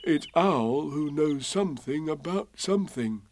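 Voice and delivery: lightly voiced musing bear; Pooh, to himself. A narrator's reading. Pooh reasoning to himself, musing. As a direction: think it through aloud. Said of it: "said Bear to himself".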